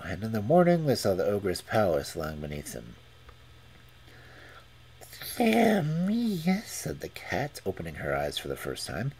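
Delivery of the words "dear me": high little cat voice; a dainty feline exclamation. Acted. Cat speaks.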